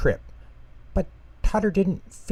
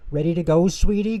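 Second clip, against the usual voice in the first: high, doting grandmother mouse voice; a kindly granny calling her grandchild; doting little granny mouse; sweet Grandma Flutter; 8 semitones higher, 5 dB louder, faster than usual. A narrator's reading.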